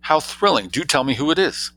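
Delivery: high-pitched, thrilled eagerness; excited. A narrator's reading. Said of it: "asked Jane excitedly".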